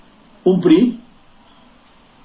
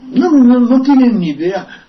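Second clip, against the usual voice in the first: higher, louder flat dismissal; a loud, high-pitched no, no, no; emphatic no; 11 semitones higher, 5 dB louder than usual. Real, not acted. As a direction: slam the idea down hard. Emphatic dismissal after the name.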